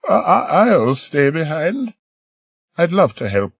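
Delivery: put-on high, quavering old woman's voice; elderly lady. Old lady volunteering to stay behind, high old voice. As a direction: play an eager elderly lady.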